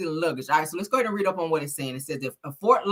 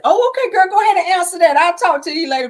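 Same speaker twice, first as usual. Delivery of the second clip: high, bright mock sweet goodbye; caller voice; 11 semitones higher, 8 dB louder, faster than usual. Grandma's caller, wrapping up the call, high mock sweet voice. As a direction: play the chatty caller rushing off the phone. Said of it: "They probably said".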